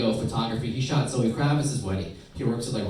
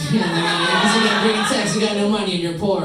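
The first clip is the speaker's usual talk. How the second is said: louder and much higher, scornful; loud mock girl; 8 semitones higher, 7 dB louder than usual. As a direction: shout it like a snobby girl. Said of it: "voicing the girl".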